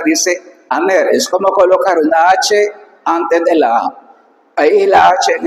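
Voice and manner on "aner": "aner" said slowly with no h at the start; teacher showing smooth breathing, soft-spoken "aner". Shown as a demonstration. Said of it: "Como dice acá".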